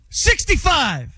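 slow, high-pitched yelled counteroffer; exasperated high haggling cry; yelling. Real, not acted. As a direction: yell the number, drawn out. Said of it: "there's no yelling that goes on".

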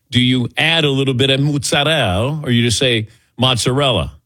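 mozzarella with an exaggerated Italian lilt; Italian flair. Shown as a demonstration. Host asking about pronunciation, hamming up mozzarella. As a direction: roll mozzarella out the way an Italian would. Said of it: "have that extra flair if I'm saying something".

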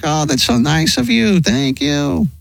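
gushing grateful guy. Retold or imitated. Truck driver thanking the wife, gushing.

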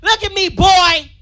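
frustrated mom lecturing her son; angry mom voice. Retold or imitated. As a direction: scold the boy over simple math. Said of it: "She's like".

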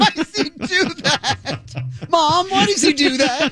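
put-on whiny kid. Kid asking mom about radio.

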